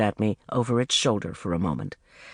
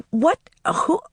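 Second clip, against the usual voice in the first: sharp, loud, higher gasps of surprise; startled; 13 semitones higher, 5 dB louder than usual. Acted. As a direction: caught completely off guard. Shocked at seeing René.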